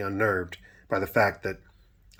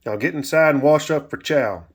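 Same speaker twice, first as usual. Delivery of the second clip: loud, raised gruff order; calmer anger; 6 semitones higher, 6 dB louder than usual. A narrator's reading. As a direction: order the child indoors, still cross. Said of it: "reverting back to his calmer state of angry".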